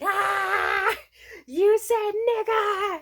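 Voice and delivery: high, loud, slow gotcha; gleeful call-out. Retold or imitated. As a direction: crow it out like you caught her. Grinning worker calls out her slip.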